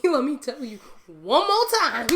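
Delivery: hammed-up final telling; emphatic insistence. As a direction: imitate an exasperated sister, playfully loud. Acts sister's emphatic insistence, laughing.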